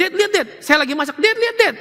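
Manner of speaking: put-on high, pleading child calling for dad; little child calling. Preacher imitates his small child calling dad, high and pleading. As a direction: play the little kid begging dad to look. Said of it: "Dia bilang".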